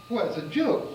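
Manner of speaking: startled protest. Retold or imitated. Teller startled by panic.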